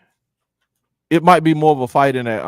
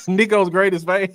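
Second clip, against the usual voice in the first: laughing; 8 semitones higher than usual. Real, not acted. Echoing the teasing nickname, laughing.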